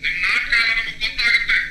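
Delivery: fast, angry tirade; rapid, heated complaint; indignant protest. Acted. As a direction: rattle off accusations and a threat. Angry protest over toll collection.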